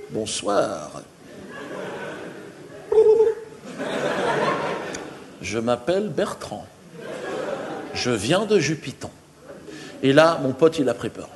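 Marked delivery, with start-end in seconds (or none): 0.1-9.1 voix distincte d'extraterrestre. Retold or imitated. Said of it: "de manière parfaitement distincte". (extraterrestre posé, voix un peu plus grave et douce)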